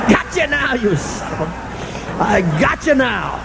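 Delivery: high, softened gloat, drawn out at the end; mock gloating. Sponsor to a newcomer caught laughing, gleefully gloating. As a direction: gloat as if you've just caught someone.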